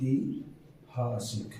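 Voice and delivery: ऐतिहासिक drawn out slowly and softly; slow soft spelling-out. Shown as a demonstration. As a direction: sound out ऐतिहासिक gently, syllable by syllable. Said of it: "ये ऐतिहासिक होता है". (ऐतिहासिक को धीरे और धीमी आवाज़ में अक्षर अक्षर बोलना)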